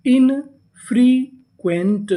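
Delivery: Infrequent very slowly, split as इन, free, Quint; syllable-by-syllable reading. Shown as a demonstration. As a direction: draw out each part of infrequent with pauses. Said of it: "पूरी meaning".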